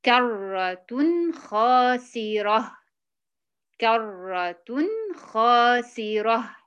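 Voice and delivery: خاسرة stretched slowly each time; slow tajweed chant; Quranic recitation demo. Shown as a demonstration. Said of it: "مثلًا كلمة".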